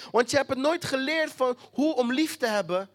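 usual speaking voice

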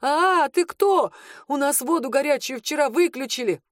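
high, panicked shout; frightened yelling. A narrator's reading. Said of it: "заорал парень испуганно".

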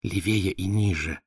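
slow hint in her voice; prompting. A narrator's reading. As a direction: guide him gently, slow. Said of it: "подсказала Валя".

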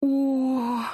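long high drawn-out oh. Acted. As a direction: stretch the oh out, high. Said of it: "протянула я". (протяжное «о»)

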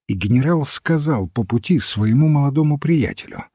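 usual speaking voice

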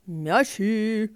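short loud call; mild market cry; vendor shout. Acted. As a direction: hawk the balls. Toy vendor shouting wares.

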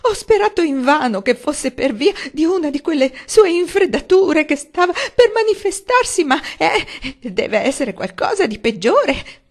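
high, loud quavering cry; tearful wailing. A narrator's reading. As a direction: weep while confessing to the family. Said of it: "apertamente in lacrime". (madre in lacrime che si lamenta)

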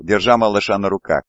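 plain reading voice

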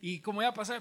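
ordinary delivery

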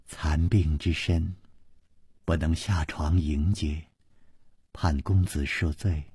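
soft, slow, frail lady's voice; gentle ailing consort. A narrator's reading. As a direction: speak slowly and softly, as if too weak to rise. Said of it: "秋瓷王妃微微一笑说".

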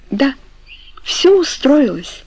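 high, slow echo of the other's words. A narrator's reading. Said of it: "ответила Франческа как эхо". (как эхо, высоко и протяжно)

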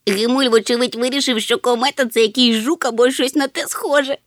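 high, loud, gleeful little creature; cheered up. A narrator's reading. Chmykh mocks Gemul's mistake about the comet, cheered up. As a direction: chatter it out high and tickled. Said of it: "повеселів Чмих".

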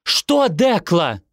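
indignant cry; shouting back. A narrator's reading. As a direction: yell back in exasperation, stretching the words. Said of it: "закричала она".

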